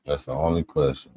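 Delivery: ordinary talk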